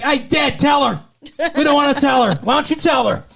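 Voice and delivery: high, loud flustered squabble; hammed-up frantic back-and-forth; mock panicked bickering. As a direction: act out passing the buck, high and loud. Acted-out bickering over bad news.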